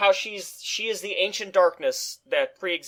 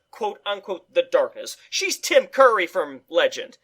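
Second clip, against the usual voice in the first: usual speaking voice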